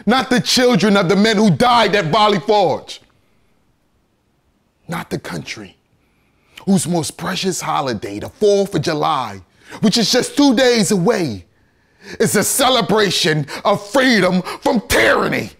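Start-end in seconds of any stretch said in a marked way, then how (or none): 0.1-3.0 high, loud, mounting indictment; impassioned courtroom speech, voice raised; building oration. Acted. 9.8-15.5 high, loud, ringing finish; impassioned orator at full voice; climactic outcry. Acted. Climax of courtroom oration.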